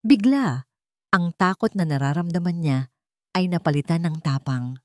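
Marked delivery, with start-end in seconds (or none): none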